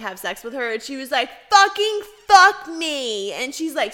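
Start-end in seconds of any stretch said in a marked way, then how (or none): 1.5-3.3 high, slow, loud mock yell; imitating an angry wife yelling at her husband; mock angry demand. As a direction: demand it in a hammed-up fury. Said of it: "so mad that he wouldn't have sex with her".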